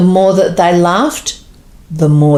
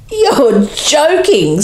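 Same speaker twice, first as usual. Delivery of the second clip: laughing; 9 semitones higher, much slower than usual. A narrator's reading. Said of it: "said through laughter and wheezing".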